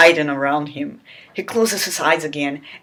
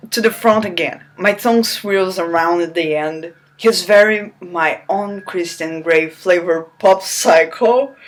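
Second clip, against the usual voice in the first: normal talking voice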